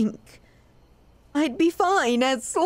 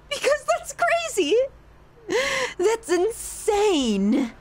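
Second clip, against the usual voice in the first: mock incredulous; 7 semitones higher than usual. Mocking what others would think.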